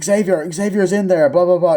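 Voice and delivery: hammed-up cry that someone is trapped; mock alarm. Retelling a panicked rescue cry.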